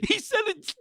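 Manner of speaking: high, squeaky voice breaking into laughs; helpless laughter. Real, not acted. Insisting he was serious, laughing helplessly. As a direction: try to confirm it while cracking up. Still cracking up, confirming the story.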